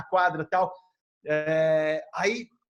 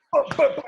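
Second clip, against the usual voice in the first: slap sounds; 8 semitones higher, 7 dB louder than usual. Retold or imitated. Imitating slaps on the chest.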